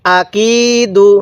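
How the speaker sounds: আকিদু read very slowly with one alif on কি; slow recitation. Shown as a demonstration. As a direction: stretch the কি in আকিদু. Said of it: "এক আলিফ টানতে হয়".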